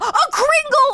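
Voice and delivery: very high loud cry of discovery; excited realization. Real, not acted. Streamer finally remembering the pastry, excited shout. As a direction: shout it like a eureka.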